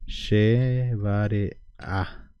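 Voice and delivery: "che vare'a" stretched very slowly, each syllable apart; teacher modelling "che vare'a" very slowly; slow spelled-out pronunciation. Shown as a demonstration. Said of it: "Che vare'a de esta manera".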